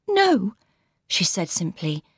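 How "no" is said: put-on higher, gentle young woman's voice; Laura's voice. A narrator's reading. Laura greeting her sister warmly, higher voice. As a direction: lift into the young bride's voice.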